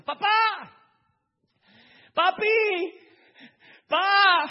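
gritos agudos y muy fuertes de niño; llama a papá a todo pulmón, desesperado. Acted. Isaac llama desde el altar. (Isaac atado llama a su padre, gritando)

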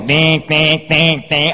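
repeated, evenly pulsed alarm noise; imitated alarm. Preacher sounding the alarms going off in hell, imitated alarm. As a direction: mimic a siren going off in steady beats. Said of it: "unas alarmas que empiezan a sonar".

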